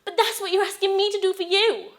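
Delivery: high, loud, distraught plea; desperate pleading. Acted. Wife refusing to lose husband, desperate tearful pleading. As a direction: beg him, close to tears.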